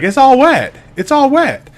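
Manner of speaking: put-on very high, loud little-girl voice; dad imitating his toddler daughter. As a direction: squeak it like a small child.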